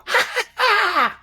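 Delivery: creepy, eerie high-pitched laugh; very high burst of hysterical laughing; hysterical laughter. Acted. Game master voicing laughter the players hear, hysterical and eerie. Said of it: "this kind of hysterical".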